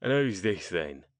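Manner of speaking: Cockney question, loud then dropping; thick Cockney. A narrator's reading. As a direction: ask about the newcomer in broad Cockney. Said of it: "in a thick Cockney accent".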